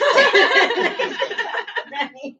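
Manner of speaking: high, breathless laughter; happy high laughter; giddy laugh. Real, not acted. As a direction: burst out laughing with joy. Laughs after shouting.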